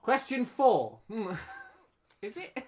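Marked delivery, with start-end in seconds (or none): 0.0-1.0 loud announcement. Real, not acted. Quizmaster cutting off an argument, loudly announcing. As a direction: QUESTION FOUR loud, high and stretched out.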